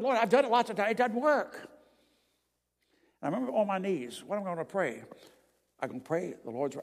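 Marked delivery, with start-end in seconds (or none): 0.0-1.7 higher, louder cry to the Lord; a boy shouting his prayer as loud as he can; retold yell. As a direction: yell it up at heaven in frustration. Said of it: "I yelled as loud as I could".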